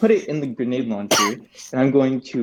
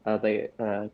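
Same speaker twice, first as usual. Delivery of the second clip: normal talking voice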